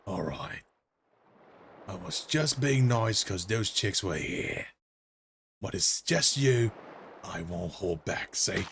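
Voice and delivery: low, subdued tough-guy character; threatening dolphin. Acted. Voices dolphin's translated threats.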